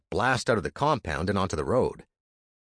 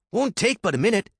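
higher, pushy younger brother; Andy's voice; 12 semitones higher, 4 dB louder than usual. Acted. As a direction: urge him on, high and eager. Andy voiced.

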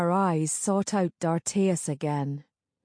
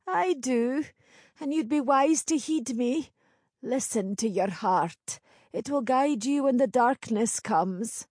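put-on higher-pitched old crone; knowing old village crone; 6 semitones higher than usual. Crone foretells.

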